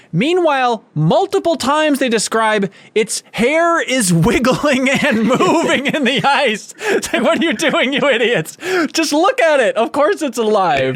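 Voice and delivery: high, laughing disbelief at idiots; laughing exasperation. Real, not acted. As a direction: laugh through it, half-exasperated. Mocking the scientists' debate.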